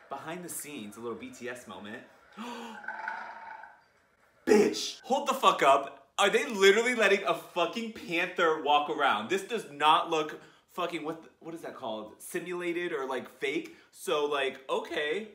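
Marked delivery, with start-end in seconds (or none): 2.4-3.7 shocked gasp. Real, not acted. Shocked by panther. 4.5-11.0 loud, stunned outburst; shocked shout. Real, not acted. Shocked at loose panther.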